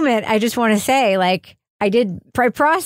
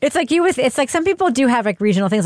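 ordinary talk, nothing marked

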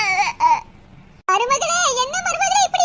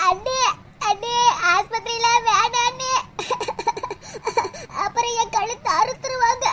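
whiny sick daughter; 6 semitones lower, 4 dB quieter than usual. Acted. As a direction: beg like a scared grown daughter with a fishbone in her throat. Sick daughter pleads in child voice.

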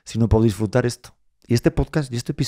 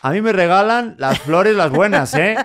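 grandfather demanding; 5 semitones higher, 4 dB louder than usual. Retold or imitated. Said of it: "Y llega él diciendo".

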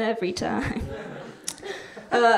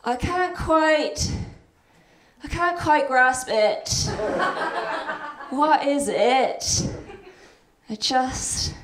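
crab impression. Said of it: "my impression of a crab talking to its therapist".

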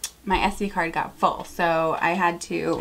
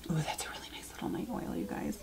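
whisper; 4 semitones lower, 15 dB quieter, faster than usual. Real, not acted. Trying the night oil.

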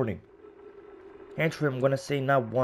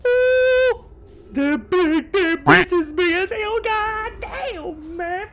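put-on high, strained voice of a spooked old-timer; high mock-shaken yarn-spinner; character voice; 6 dB louder, much slower than usual. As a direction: ham up the storyteller's dread. Voicing the character's story.

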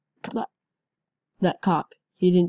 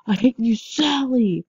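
loud, slow, high furious shout at Sally; angry yell; 5 semitones higher, 5 dB louder than usual. Acted. Gamer yelling at cousin Sally in game, furious shout. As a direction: yell the grudge out, drawn out. Player voicing grudge at game character.

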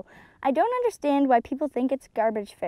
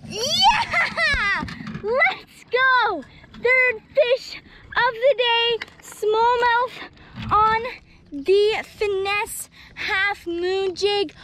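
excited celebration; 8 semitones higher, much slower than usual. Real, not acted. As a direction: celebrate like you just won. Landed the smallmouth, celebrating.

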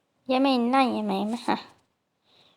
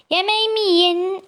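mock invitation; 7 semitones higher, 5 dB louder than usual. Real, not acted. Taunting players about her food.

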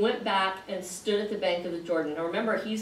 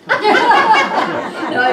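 loud burst of laughter into a high "no"; laughing; 13 semitones higher, 15 dB louder than usual. Real, not acted. Teacher reassures a student who said never mind, laughing. Laughing at listener's withdrawn remark.

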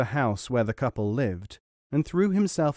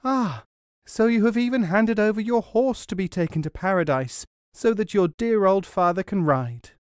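high, bright delighted call; 7 semitones higher than usual. Acted. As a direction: call out to him in sheer delight. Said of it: "called out to him in delight".

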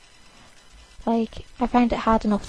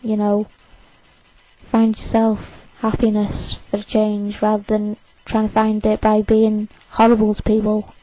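ordinary talk, nothing marked

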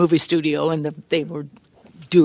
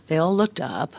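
usual speaking voice

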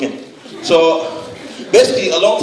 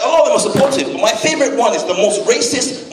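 usual speaking voice

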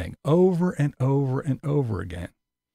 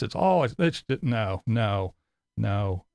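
usual speaking voice

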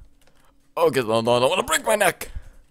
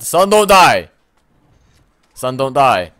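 higher, louder panicked plea; pleading; 6 semitones higher, 12 dB louder than usual. Acted. Gamer pleading with his son character, loud and high. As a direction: beg the character to live.

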